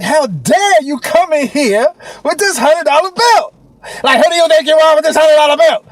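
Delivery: high, loud imitation of an affronted cashier; mock indignant attitude. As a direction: scold as if personally insulted. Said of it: "like she had an attitude".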